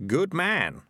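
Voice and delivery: lightly voiced character in charge; Morris in control. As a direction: address a captive coolly.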